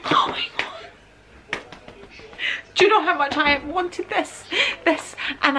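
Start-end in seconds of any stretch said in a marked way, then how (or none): 0.0-1.6 breathless, slow whispered gasp of awe; awed whisper. Real, not acted. To herself, opening the bag she always wanted, awed whisper. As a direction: whisper it as if you can't believe it.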